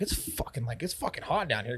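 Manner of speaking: breathy whisper, almost no voice; whispered it's hot in here; whispered complaint. Retold or imitated. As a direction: lean in and whisper it like a secret gripe. Hushed complaint to friends about heat.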